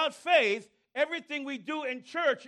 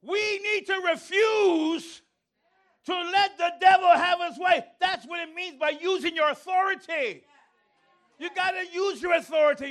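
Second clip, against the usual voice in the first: preaching shout; 6 semitones higher, 4 dB louder than usual. Acted. Preaching at full force.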